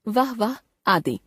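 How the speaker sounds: usual speaking voice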